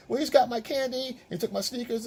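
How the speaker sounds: lightly voiced kid tattling; whiny kid. Child voice in family scene.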